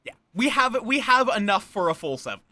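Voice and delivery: ordinary talk, nothing marked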